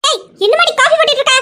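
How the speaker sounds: high, loud shout towards the kitchen; impatient yell. Acted. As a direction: call out to hurry her. Sister-in-law yells about coffee. (அவசரப்படுத்தும் கூச்சல்)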